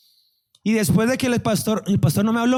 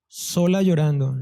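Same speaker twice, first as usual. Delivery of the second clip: ordinary talk, nothing marked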